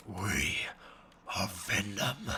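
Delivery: low, guttural monster growl; Venom. Acted. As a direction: growl it in slow, separate bursts. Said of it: "I don't know what he sounds like".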